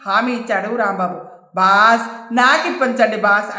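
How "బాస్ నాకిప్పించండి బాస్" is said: plain reading voice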